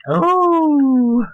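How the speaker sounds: high, loud drawn-out hoot; long high "oh". Pretend. Host clowning before the podcast starts, high hooted oh. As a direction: swoop up high and hold it. Performed intro hoot, repeated.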